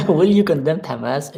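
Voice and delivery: half-hearted imitation of a British interviewer, starting high and loud; lightly mock a pressing TV interviewer's question; Piers Morgan. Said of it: "in my best Piers Morgan accent".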